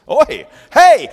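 high, loud call of Oi, hey. Shown as a demonstration. Someone calling out for attention, a loud hail. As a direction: hail someone across a crowd. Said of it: "someone is calling out for your attention".

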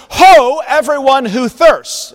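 high, loud proclamation of Ho; heralding call. Acted. Prophet calling the thirsty to come, in a loud heralding call. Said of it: "you can actually hear this quite clearly".

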